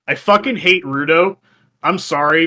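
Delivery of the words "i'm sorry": ordinary talk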